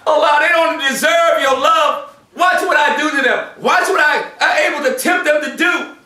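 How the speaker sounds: high, shouted gloating to God; Satan shouting his case against people to Allah, gloating; taunting devil. Acted. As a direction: crow over the sinners like Satan bragging. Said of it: "to prove to Allah".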